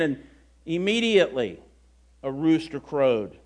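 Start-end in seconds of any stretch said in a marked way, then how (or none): none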